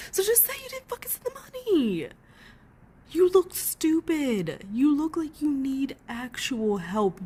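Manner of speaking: high, stretched exasperated cry; exasperated yell. Real, not acted. Streamer berating the actress on screen, high exasperated cry. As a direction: throw it at the screen in disbelief.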